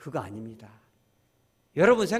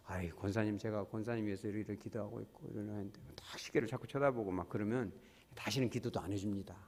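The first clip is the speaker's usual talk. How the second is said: low and much softer; soft grumbling aside; 6 semitones lower, 11 dB quieter than usual. Retold or imitated. Pastor complaining to a deaconess checking her watch, muttered. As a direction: mutter it half-jokingly.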